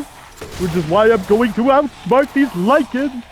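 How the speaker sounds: hammed-up Yogi voice boasting; Yogi Bear. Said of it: "in a classic cartoon voice".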